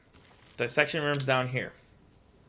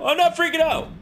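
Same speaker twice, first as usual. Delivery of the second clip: much higher, louder panicked yell; panicked shout; 7 dB louder than usual. Real, not acted. Gamer to viewers, denying his fear, panicked shout. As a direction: scream the denial in real panic.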